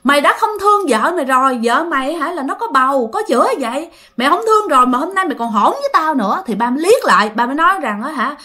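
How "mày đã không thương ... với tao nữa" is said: acted exasperated scolding, pitched up; chửi mắng. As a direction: cry out, then berate the son. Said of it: "Cho nên bà nội mới chửi". (giọng mẹ bực tức chửi con trai)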